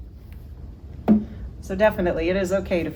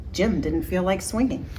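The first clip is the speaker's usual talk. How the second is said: usual speaking voice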